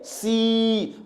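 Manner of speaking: 'सी' held on a long ee; long sustained ee in 'सी'; drawn-out vowel. Shown as a demonstration. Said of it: "sound को खींच रहा हूं".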